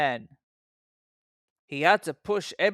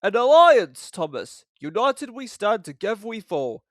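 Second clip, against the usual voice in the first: louder, pompous proclamation; grand Gordon; 5 semitones higher, 8 dB louder than usual. A narrator's reading. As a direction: declaim it grandly. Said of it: "said Gordon grandly".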